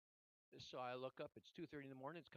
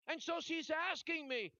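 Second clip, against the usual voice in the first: loud, high-pitched preacher at full force; urgent pulpit shout; impassioned preaching; 12 semitones higher, 14 dB louder than usual. Real, not acted. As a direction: preach it hard, voice raised high.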